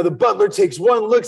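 normal talking voice

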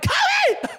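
a high, loud yell of the name; excited shout. Retold or imitated. Fan at courtside shouting to Kobe, high and loud. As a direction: call out to a star like a starstruck fan.

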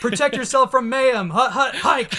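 loud, high snap count with a laugh; quarterback calling cadence. Pretend. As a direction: shout the cadence, cracking up. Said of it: "change their audibles to be ads".

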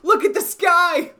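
high, loud mimicked shouting; excited yelling. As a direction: echo the neighbor's amazed yell. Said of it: "mimicking the neighbor".

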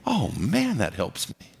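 ordinary talk, nothing marked

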